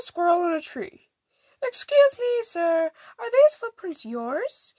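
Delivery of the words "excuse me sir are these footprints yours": higher, polite and careful. A narrator's reading. Wolf politely asks the squirrel, light and courteous. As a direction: ask the squirrel sweetly, slowly. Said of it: "he asked him politely".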